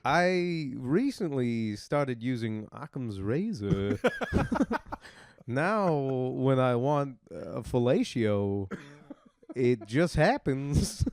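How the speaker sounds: slow, halting Walken voice; odd slow pauses like Walken; Walken impression. Walken bit continues.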